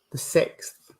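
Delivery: a whispered note to herself, "The sixth"; hushed repeat of "The sixth"; whispered echo. Real, not acted. Medium echoing the date to herself.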